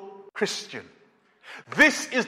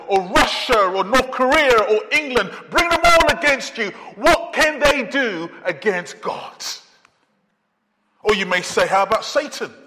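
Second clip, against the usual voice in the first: full force preaching; 3 semitones higher than usual. Acted. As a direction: throw down the dare to every enemy.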